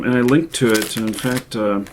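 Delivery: ordinary talk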